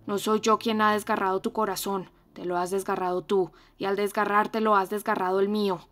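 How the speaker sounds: loud, fierce reproach; ferocious. Acted. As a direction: hurl the blame at her, fiercely. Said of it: "le dijo con acento feroz".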